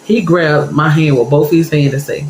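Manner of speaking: ordinary talk, nothing marked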